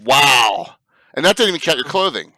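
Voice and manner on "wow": amazed. Real, not acted. Reacting to seven suitcases.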